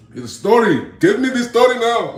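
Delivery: loud, high-pitched mock Indian accent; shouted caricature of an Indian accent. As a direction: demand it in a hammed-up Indian accent. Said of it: "that was my Indian accent".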